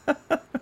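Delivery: high, soft burst of laughter; amused laugh. Real, not acted. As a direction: crack up at a funny line. Amused by the article's line.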